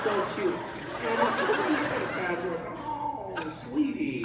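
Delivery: retelling the fan's reaction in a light cooing voice; mock sweet voice. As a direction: coo it like a doting adult, lightly mocking. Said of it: "She said".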